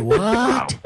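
high, startled "What?" in disbelief; shocked. Retold or imitated. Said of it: "because I was just that shocked".

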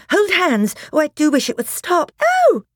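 very high, loud distressed cries; plead, then cry out; panicked plea. Acted. Susan wishing the pulling would stop, panicked plea.

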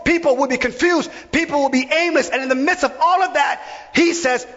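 impassioned preaching. Real, not acted. Preacher lists what happens where compassion is missing, impassioned preaching. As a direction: hammer out each repeated phrase like a preacher.

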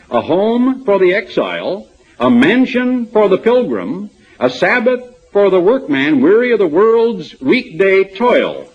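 lofty recitation of a commentary passage; dramatic reading. Shown as a demonstration. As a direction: read it like a grand pulpit quotation. Reads ornate commentary quote.

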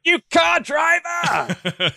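high, slow, stretched-out mock yell at a driver; mock road rage insult. Mocking a bad driver, high stretched mock yell. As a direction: sneer it like a furious driver.

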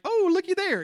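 high, loud breezy pretend-nothing-happened voice; mock casual denial. Pretending to a fallen child that all is fine, breezy and high. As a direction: chirp it like you saw nothing. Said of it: "do I need to pretend like".

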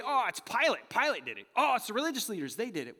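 loud, high-pitched finger-pointing excuse; mock blame-shifting. Crowd blaming Pilate and religious leaders, loud mock excuse. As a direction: leap high on each new culprit.

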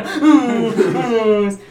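very loud, drawn-out holler; hammed-up astonished yell; mock amazement. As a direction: stretch it into a huge goofy shout, laughing. Repeats the shouted quote.

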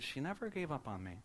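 soft, wondering retold quote; hushed retelling in one unbroken phrase; struck by realisation. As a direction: say it softly, as if it just struck you. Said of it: "like it struck him as he said it".

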